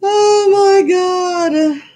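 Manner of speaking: slow, high exasperated gasp of disbelief; long, high groan of disbelief; drawn-out oh my God. Real, not acted. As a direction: stretch it out in dismay. Exasperation at tiny bank balance.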